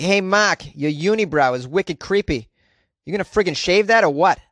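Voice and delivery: put-on ribbing a friend in a Boston accent. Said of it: "That's how we say it in Boston".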